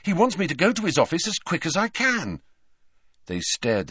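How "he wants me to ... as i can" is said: high-pitched, urgent excitement; eager and raised in pitch; excited. A narrator's reading. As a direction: blurt out the exciting news. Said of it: "said Harry excitedly".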